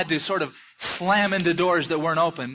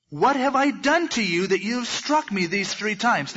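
a lightly voiced, higher hurt donkey; talking donkey; 5 semitones higher than usual. Donkey protesting to Balaam, mildly hurt and higher. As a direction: plead as the wronged animal.

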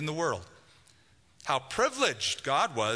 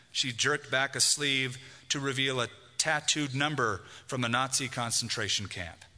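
usual speaking voice

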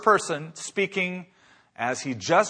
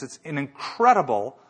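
ordinary talk, nothing marked

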